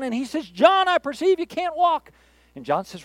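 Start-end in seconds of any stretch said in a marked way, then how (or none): 0.5-2.1 high, drawn-out faith healer proclamation. Acted. Faith healer to man on crutches, mock grand proclamation. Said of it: "a faith healer that stands up".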